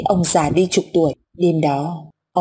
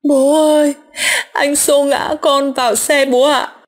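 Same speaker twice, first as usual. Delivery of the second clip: put-on high, drawn-out crying child voice; tearful, choked wail; 7 semitones higher than usual. A narrator's reading. Dead son calling to his father, tearful wail. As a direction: wail it slowly like a wronged little boy. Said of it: "Kèm theo tiếng khóc nức nghẹn oan ức".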